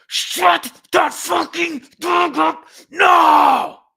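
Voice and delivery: huge, high, slow bellowing yell; all-out furious shout at the neighbour. Retold or imitated. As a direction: scream it down from the balcony. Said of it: "leaned over and yelled".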